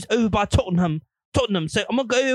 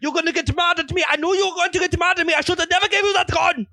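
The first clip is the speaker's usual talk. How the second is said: mock angry scold; 9 semitones higher than usual. Hitler scolding his girlfriend, high and whiny. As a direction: rant at her like a betrayed boyfriend.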